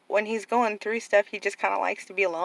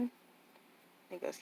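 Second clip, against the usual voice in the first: ordinary talk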